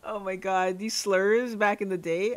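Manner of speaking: ordinary delivery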